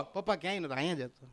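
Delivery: soft, mild answer to dad; quiet, casual denial; meek son's reply. Acted. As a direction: say it small and harmless. Son calmly answering his father.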